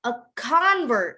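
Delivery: "convert" with drawn-out CON, falling vert; stress on first syllable. Shown as a demonstration. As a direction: slowly lean on the start of "convert". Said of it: "you want to emphasize the beginning".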